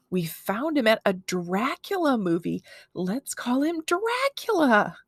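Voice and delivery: building to a high, drawn-out DRACULA; slow, sing-out flourish on the name; excited kid's suggestion. A narrator's reading. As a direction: pitch the name with big excitement. Excited boy proposes a name.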